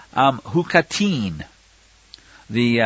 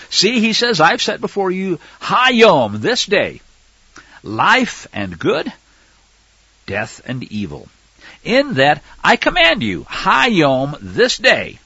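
raised, emphatic reading voice; scripture reading; 5 semitones higher, 3 dB louder, slower than usual. Acted. Preacher reading Deuteronomy aloud, emphatic. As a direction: proclaim the verse to the flock.

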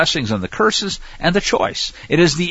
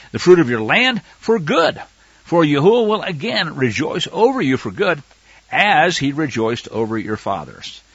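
raised, emphatic reading voice; scripture reading. Acted. Preacher reading Deuteronomy aloud, emphatic. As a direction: proclaim the verse to the flock.

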